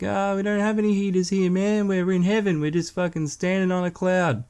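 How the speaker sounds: god cheerfully explaining heaven has no heaters; God's voice. Retold or imitated. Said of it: "He's like".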